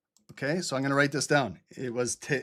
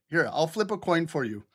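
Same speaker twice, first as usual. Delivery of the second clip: usual speaking voice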